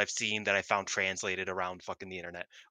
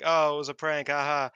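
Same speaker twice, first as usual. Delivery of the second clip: mock breezy prank; 6 semitones higher, 5 dB louder than usual. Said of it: "Tam's like".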